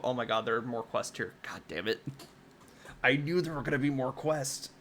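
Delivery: usual speaking voice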